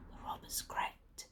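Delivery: hushed whisper. Acted. Narrator to children, whispering as robbers creep. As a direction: whisper like sneaking thieves. Robbers sneaking through silent rooms.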